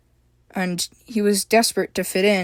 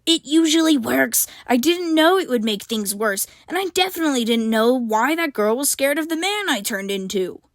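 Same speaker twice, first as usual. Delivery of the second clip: lightly voiced little satyr, higher, defensive; protesting satyr; 5 semitones higher than usual. A narrator's reading. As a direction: protest like a small guilty creature. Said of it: "the small satyr protested".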